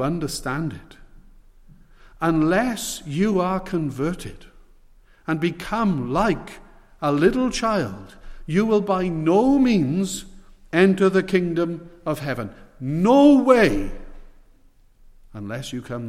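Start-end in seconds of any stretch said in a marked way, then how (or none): 2.2-12.5 slow and weighty, every phrase pressed; slow emphatic warning. Acted. Preacher underlining Jesus' warning. 12.8-13.9 loud, higher burst of insistence; emphatic cry. Acted. Preacher hammering the point.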